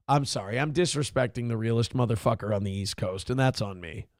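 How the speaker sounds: low, soft mock contrition; sarcastic apology. Comedian mocking the passenger with quiet fake remorse.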